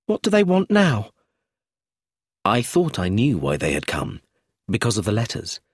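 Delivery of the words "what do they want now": high, anxious question; nervous, pitch jumping up. A narrator's reading. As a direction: ask it on edge, high. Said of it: "said Brenda nervously".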